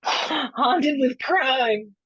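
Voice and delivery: drawn-out, high ghostly wail; mock spooky. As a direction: stretch it out like a pretend ghost. Mock spooky echo of haunted joke.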